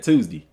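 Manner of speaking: "Tuesday" said as "Toozdee", ending in "dee"; "Tuesday" with a clipped "dee" ending; his own Tuesday. Shown as a demonstration. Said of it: "how I say it".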